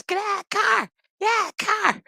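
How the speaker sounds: very high, strained police yell; comic squealed command; mock cops yelling. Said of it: "You hear the cops".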